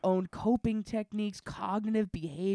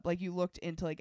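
usual speaking voice

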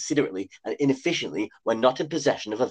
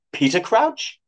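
surprised; 7 semitones higher, 3 dB louder, slower than usual. A narrator's reading. Shay's startled question.

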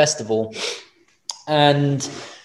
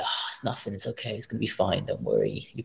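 quiet inner voice; 3 semitones lower, 11 dB quieter than usual. Retold or imitated. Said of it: "In the back of my head".

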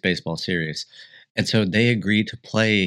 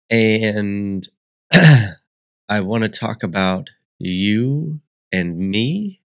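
drawn out very slowly, louder, like a grand announcement; slow dramatic build; 4 dB louder, much slower than usual. Acted. Host hyping the episode's topics, slow and grand. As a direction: stretch every word for drama.

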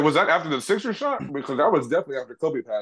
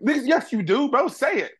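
excited call-out; 9 semitones higher, slower than usual. Real, not acted. Calling out haters, pitch jumps.

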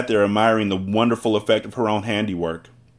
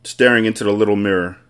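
ordinary delivery, nothing marked

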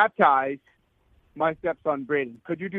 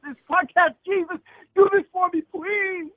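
pleading; 13 semitones higher than usual. Real, not acted. Begging podcast host, near tears.

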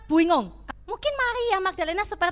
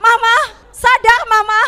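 loud, urgent calling of a mother's name; desperate shaking call; 7 semitones higher, 11 dB louder than usual. Retold or imitated. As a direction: shout her awake, desperate. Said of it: "Digoncang-goncangkan".

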